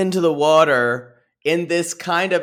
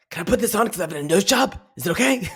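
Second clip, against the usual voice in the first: high, fast, anxious little voice; mock anxious quote; 5 semitones higher, much faster than usual. Quoting a cast member's nervous question, high and fast. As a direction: squeak out a nervous worry. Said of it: "they're like".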